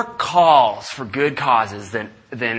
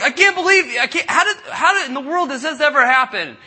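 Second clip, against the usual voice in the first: much higher, laughing and amazed; laughing disbelief; 11 semitones higher than usual. Retold or imitated. Friends laughing at the unlikely phone call. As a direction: laugh through the words in wonder.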